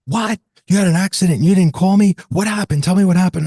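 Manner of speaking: hammed-up frantic son; panicked son. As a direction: fire questions at dad like a worried son. Son panics over father's accident.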